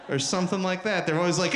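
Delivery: ordinary delivery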